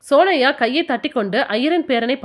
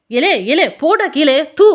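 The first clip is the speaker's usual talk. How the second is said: loud alarmed cry; mother yelling at her son; shouting; 3 semitones higher, 6 dB louder than usual. A narrator's reading. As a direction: yell to stop him eating it. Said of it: "என்று கூவியவாறு".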